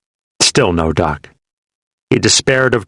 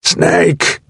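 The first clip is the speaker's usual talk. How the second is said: strained cry of a wounded man; pained call; 9 semitones higher than usual. A narrator's reading. Wounded soldier calling his buddy in pain. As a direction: call out high and hurting. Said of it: "he grunted painfully".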